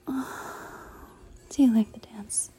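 ordinary delivery

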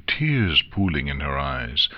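ordinary delivery, nothing marked

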